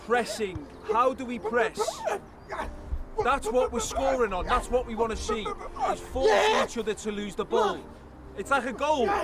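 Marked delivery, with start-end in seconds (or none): none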